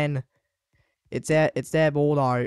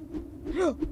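high unvoiced gasp; sharp gasp; 13 semitones higher, 7 dB quieter than usual. Real, not acted. Gamer gasps at what Brad wrote. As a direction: gasp in shock. Shock at teammate's message.

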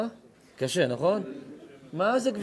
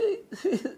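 a real high laugh breaks out; high burst of laughter; 12 semitones higher, 6 dB quieter than usual. Real, not acted. Storyteller laughing at his own joke, high real laugh.

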